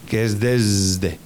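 "desde" slowed right down, voiced z before the d. Shown as a demonstration. Teacher slowly modelling the buzzing s in "desde". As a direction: stretch out "desde" so the s buzzes. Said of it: "el que está en la S de la palabra desde".

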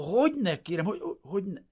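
high, loud, flustered stuttering; frightened, obedient stammer. A narrator's reading. Refugee answering the official about the party, frightened stammer. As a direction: stammer out eager agreement, high and scared. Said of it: "Hebegte ilyetten is engedelmesen".

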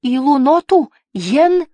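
put-on high, loud old woman's cry; slow, high-pitched shout in a strange tongue. A narrator's reading. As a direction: call back urgently as a little old lady. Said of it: "отвечала старушка".

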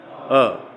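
अ said with the mouth narrowed; a short, contracted अ, not opened into आ; closed short अ. Shown as a demonstration. Teacher shows students the Sanskrit vowel, closed अ. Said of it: "मुख को इसे संकुचित कर अ बोलना".

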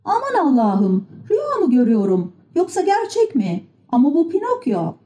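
astonished cry at normal volume, pitch climbing; amazed exclamation. A narrator's reading. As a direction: call out in disbelief. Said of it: "işaret etti ve bağırdı". (şaşkınlıkla sesleniş)

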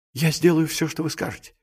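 high, fading, meek promise; timid submission. A narrator's reading. Cowed clerk obeying his accomplice, timid and fading. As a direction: start meekly high and fade to a hush.

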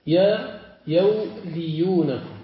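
يوليونكم drawn out slowly, syllable by syllable; slow underlying form. Shown as a demonstration. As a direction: spell out يوليونكم slowly like a demo. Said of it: "الأصل".

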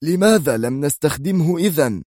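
high-pitched, indignant question; angry. A narrator's reading. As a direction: demand an answer angrily. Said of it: "فهتف في غضب". (بغضب)

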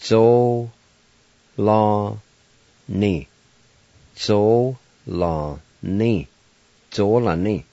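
Zo-la-ni in three clear syllables, zo-la-NI; Cherokee word for window. Shown as a demonstration. Teacher pronouncing the Cherokee word for window, careful and clear. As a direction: say Zo-la-ni syllable by syllable for learners. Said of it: "It's pronounced like this".

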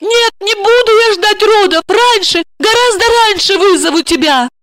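high, pressing pleas; high desperate promise to her friend; desperate begging. Acted. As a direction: beg her friend not to leave. Olga begging on her knees.

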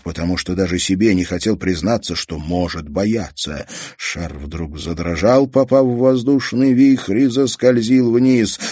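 ordinary delivery

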